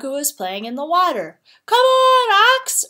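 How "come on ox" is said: high, loud cheering-on; urging. A narrator's reading. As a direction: urge a slow friend onward, loudly. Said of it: "urged Rat".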